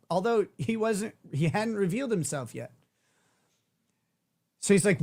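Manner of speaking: usual speaking voice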